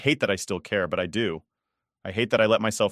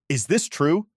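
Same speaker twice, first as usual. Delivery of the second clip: high, louder stern grandmother; demanding grandma; 7 semitones higher, 3 dB louder, slower than usual. Acted. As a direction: cut in like a knife. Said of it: "she demands".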